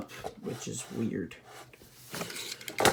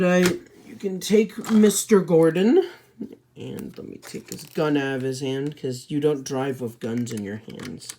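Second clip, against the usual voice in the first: usual speaking voice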